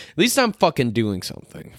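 ordinary talk

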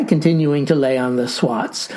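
ordinary delivery

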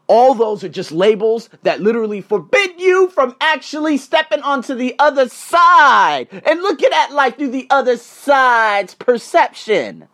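high, loud sermon-like crescendo; impassioned preaching. Real, not acted. Speaker urging Americans past labels, impassioned preaching. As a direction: drive it home, stretching the final words out.

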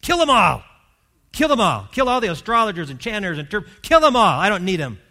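loud, high furious royal command; shouted death order, raging; furious king. Retold or imitated. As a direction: bellow the order like an enraged king. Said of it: "He is furious".

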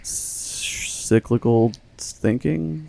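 each syllable drawn out slowly; slow careful pronunciation. Shown as a demonstration. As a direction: spell it out by sound, slowly. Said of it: "What is that called?".

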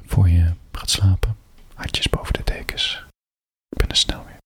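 hushed goodnight to the listeners; bedtime whisper. Real, not acted.

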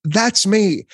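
high, loud, delighted "shut up, that's me"; reading a friend's book and seeing yourself in it, squealing with delight; excited disbelief. Retold or imitated. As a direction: squeal it like you can't believe it. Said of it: "I'm like".

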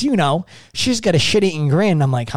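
ordinary delivery

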